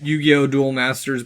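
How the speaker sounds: normal talking voice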